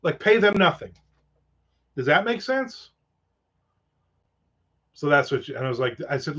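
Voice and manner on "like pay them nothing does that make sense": higher, exasperated disbelief; indignant retort. Retold or imitated. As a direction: scoff at the speaker's idea, voice climbing. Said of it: "And I'm like".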